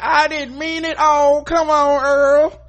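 high, loud, slow mock pleading; pleading wife. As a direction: call him back, begging. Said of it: "and then she's like".

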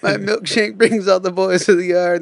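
ordinary talk

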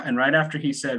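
usual speaking voice

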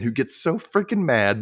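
usual speaking voice

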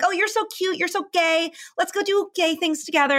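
high-pitched mock gushing; patronizing coo, pitched well up; cooing over a pet. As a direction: imitate someone fawning over a pet. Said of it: "they kinda treat 'em like a pet".

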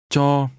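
"Cho" held on a level mid tone. Shown as a demonstration. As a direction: say "Cho" evenly, with neither rise nor fall. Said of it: "Notice the mid-level tone".

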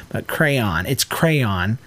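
crayon as two syllables, CRAY-on; standard pronunciation. Shown as a demonstration. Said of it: "It sounds like it's the word cron".

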